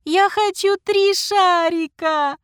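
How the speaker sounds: put-on high, slow, bawling little girl; loud wailing child. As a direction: wail it like a demanding child. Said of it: "ревела маленькая девочка так громко".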